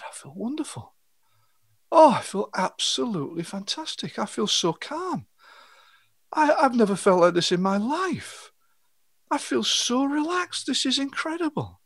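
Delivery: a client waking from trance, amazed at the calm; blissful relief. Retold or imitated. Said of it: "he said".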